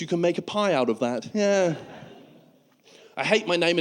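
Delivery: usual speaking voice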